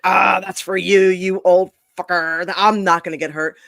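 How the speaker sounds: loud, imitating a cocky youngster; mock cocky dismissal. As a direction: brush off the warning, hammed up. Mock-quoting cocky young wrestler.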